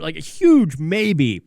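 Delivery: "huge" stretched slow and leaned on; a drawn-out, heavy "huge maybe"; stress on huge. Real, not acted. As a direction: draw out "huge" to doubt it. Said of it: "and I stress the word huge".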